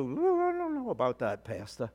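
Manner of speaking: a lightly voiced skeptic in the pews; doubtful churchgoer. Pastor mocking a doubtful listener, soft and quick. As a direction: mutter a hint of doubt, softly. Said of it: "some of you could go".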